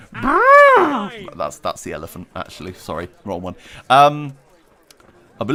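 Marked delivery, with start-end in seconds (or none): 0.1-1.4 high loud lip-trill imitation; mock blubbering. Mocking Boris's bluster for chat, high loud lip trill. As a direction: blow a silly raspberry like a babbling politician. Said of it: "he normally just goes".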